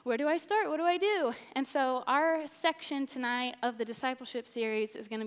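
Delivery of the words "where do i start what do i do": mock confused believer. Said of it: "it's like".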